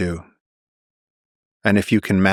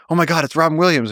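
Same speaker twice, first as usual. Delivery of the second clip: high, fast, excited gasp of a fan; starstruck kid; 9 semitones higher, faster than usual. Retold or imitated. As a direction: blurt it out like a thrilled eleven-year-old. Starstruck kid meets celebrity.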